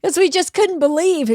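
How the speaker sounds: ordinary talk, nothing marked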